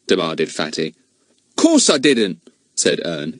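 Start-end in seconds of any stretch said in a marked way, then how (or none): 1.6-2.4 annoyed. Acted. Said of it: "even more annoyed".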